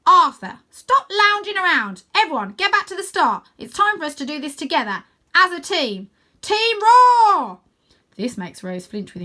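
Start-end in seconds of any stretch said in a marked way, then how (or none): 0.1-6.1 high, loud commanding shout; bossy orders. Acted. Stella ordering everyone back to the start, loud. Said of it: "then says". 6.4-7.6 very high, loud team chant; rally the whole team; rallying shout. Acted. Stella rallying Team Roar, shouting. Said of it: "then says".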